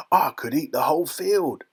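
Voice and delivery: gleeful grandpa character voice; delighted grandpa. A narrator's reading. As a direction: grin through it as a thrilled grandfather. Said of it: "grinning with delight".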